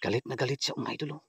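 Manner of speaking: low, soft murmur to viewers; hushed aside. Real, not acted. Vlogger tells viewers about the angry spirit, hushed aside. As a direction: keep it down and confide in the audience.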